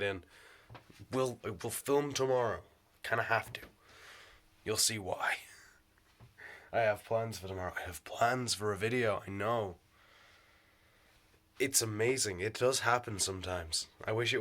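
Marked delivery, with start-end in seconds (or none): none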